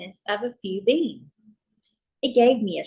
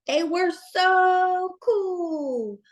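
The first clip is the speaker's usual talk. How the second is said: slow, higher drawn-out gush; mock awe; 9 semitones higher, much slower than usual. A narrator's reading. Narrator gushing over old friends turned cool, drawn-out. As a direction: stretch it out in exaggerated awe.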